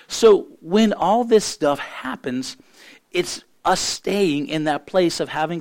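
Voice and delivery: rising, fervent sermon delivery; preaching. Acted. Pastor preaching to his congregation about speaking life, fervent. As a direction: preach it out, building conviction.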